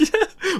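short high laugh; high burst of laughter. Real, not acted. Laughs at own reply. (hohes kurzes Auflachen)

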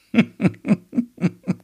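hohes, helles Auflachen; lachen. Acted. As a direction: kurz und hoch loslachen. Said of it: "Reika lacht". (amüsiertes Kichern)

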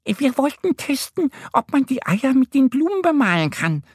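very high squeaky duck voice; little duck. A narrator's reading. Annie the duck explaining to the hare, squeaky. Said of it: "sagt Annie".

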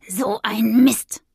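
slow, drawn-out curse; frustrated growl. A narrator's reading. Witch finding her potion almost empty, growling. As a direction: curse under mild frustration, stretching it. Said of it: "knurrte sie".

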